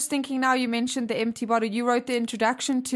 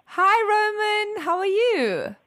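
higher, louder bright hello; 9 semitones higher, 4 dB louder, slower than usual. Real, not acted. Greeting a seven-year-old.

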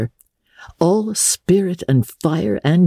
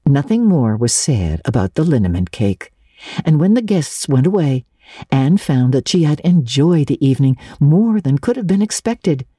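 ordinary delivery, nothing marked

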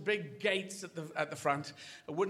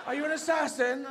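high, hesitant questioning voice; unsure dog; 9 semitones higher, 4 dB louder than usual. Acted. Dog questioning possible assassin, high and unsure. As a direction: ask it like a doubtful dog. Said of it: "rarely heard an unsure dog".

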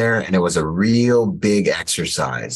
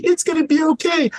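much higher, mocking movie-hero reassurance; mock superhero. Mocking a movie superhero's empty promise, much higher voice. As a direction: parody a hollow hero line. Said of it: "I hate it when I see a superhero in a movie".

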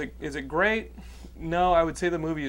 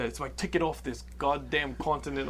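rough attempt at DiCaprio's Blood Diamond accent; badly faked film-hero accent; shitty movie accent. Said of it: "Can you do the accent?".

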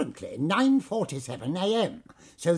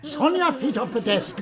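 usual speaking voice

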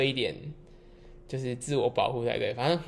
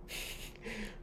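breathy laugh; 15 dB quieter than usual. Real, not acted. Laughing at own joke.

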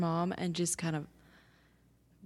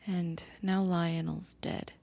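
whisper; slower than usual. Acted. Player whispers grief.

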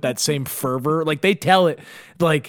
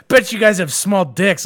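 put-on drunk taunt; 4 semitones higher, 4 dB louder than usual. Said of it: "She goes".

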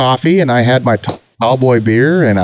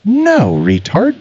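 mocking retort; 9 semitones higher, much slower than usual. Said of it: "He goes".

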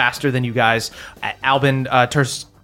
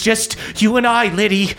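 a lightly voiced cult leader, somewhat higher; a hint of a cornered leader clinging to her follower; Sister Rosaline pleading; 7 semitones higher than usual. Sister Rosaline begs Liddy to stay loyal, lightly voiced. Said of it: "looks at Liddy and goes".

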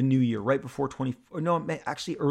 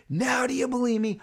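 put-on much higher, teasing teen voice; triumphant son; 10 semitones higher than usual. Son gloats to his doubting dad, high teasing. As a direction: gloat like a kid who was right all along. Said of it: "And he's like".

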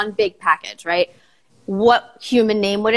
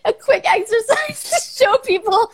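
high, giggly voice; trying not to laugh; giggling; 12 semitones higher than usual. Real, not acted. Giggling while explaining.

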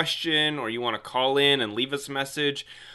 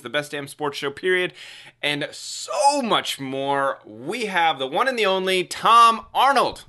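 higher, louder showman guest introduction; announcer intro; 5 semitones higher, 4 dB louder than usual. Acted. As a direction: hype the guest like a game show host.

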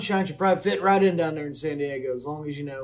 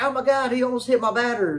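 whiny manager; 6 semitones higher than usual. Retold or imitated. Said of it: "the Reds manager's like".

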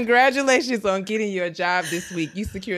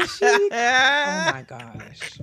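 breathless laugh; 8 semitones higher, much slower than usual. Real, not acted. Winded after laughing fit.

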